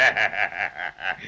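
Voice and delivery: low gloating pirate laugh; deep menacing chuckle; evil pirate laugh. Acted.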